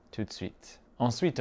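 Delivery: normal talking voice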